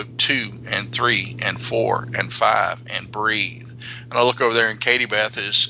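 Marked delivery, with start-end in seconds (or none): none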